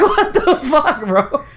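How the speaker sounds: high, very slow laughing disbelief. Retold or imitated. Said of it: "I was like".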